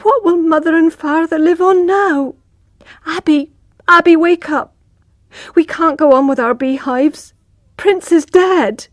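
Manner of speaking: waking her brother in panic; distressed. Acted. Tess calling her brother awake beside the dead horse, high and anguished.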